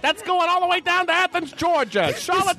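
put-on breathless old-time newsreel announcer; old-time radio announcer. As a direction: hype it like a 1930s radio man. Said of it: "C B S boys from nineteen thirty-eight".

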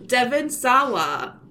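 "Sawa" slow and deliberate, SAH-wah; corrected name. Shown as a demonstration. Host resets after joking, careful "Sawa" as SAH-wah. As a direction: spell out "Sawa" slowly by ear, SAH-wah.

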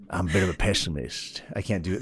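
quiet, lightly voiced cartoon gopher. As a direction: slip into a soft gopher voice. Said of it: "Now I just sound like Gopher".

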